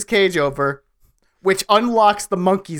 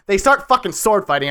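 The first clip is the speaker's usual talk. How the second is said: ordinary talk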